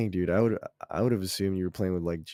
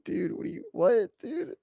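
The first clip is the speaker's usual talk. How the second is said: put-on baffled kid. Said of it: "it's like".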